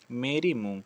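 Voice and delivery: ordinary talk, nothing marked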